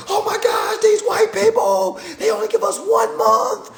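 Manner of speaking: high, loud complaining whine; mock your younger self's outrage; whiny past self. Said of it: "And I used to fight like".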